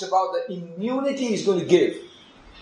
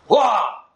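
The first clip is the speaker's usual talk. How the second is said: mock shocked exclamation; 6 semitones higher, 5 dB louder than usual. Pastor exclaims at girl's claim.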